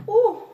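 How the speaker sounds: high, loud ooh; pleasured ooh. Shown as a demonstration. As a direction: burst out with a thrilled ooh. Reacting to the move she describes.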